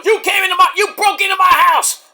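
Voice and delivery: high mock-outraged shout; imitated wrestler's angry rant; Adam Page yelling. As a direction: yell the accusation way up high. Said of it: "Page and Swerve are yelling at each other".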